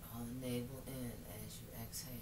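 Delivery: soft, calm meditation voice; guided meditation. Acted. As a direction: guide them gently into stillness. Leads yoga practice softly.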